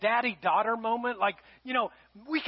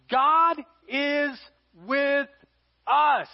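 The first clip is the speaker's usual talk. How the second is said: loud, high, each word set apart; proclaiming; 4 semitones higher, 5 dB louder, much slower than usual. Acted. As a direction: preach it out word by word. Preacher proclaims the meaning.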